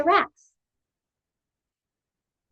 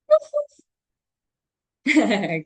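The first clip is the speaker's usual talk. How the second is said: dog bark imitation; 4 semitones higher, 4 dB louder than usual. Shown as a demonstration. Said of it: "Like this".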